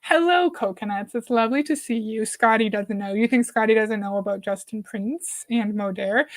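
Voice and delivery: usual speaking voice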